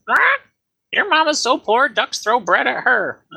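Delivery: squawky high parrot insult. Acted. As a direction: screech it like a cheeky parrot. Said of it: "he says".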